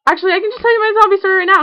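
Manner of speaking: rushing into the story; excited rush. Real, not acted. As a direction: blurt it out, suddenly keen. Sudden idea to tell story.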